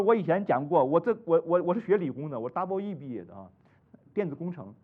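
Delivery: normal talking voice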